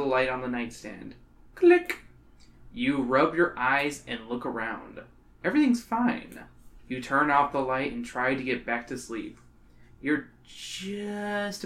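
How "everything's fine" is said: usual speaking voice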